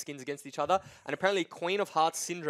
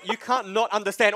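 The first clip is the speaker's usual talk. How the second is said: ordinary delivery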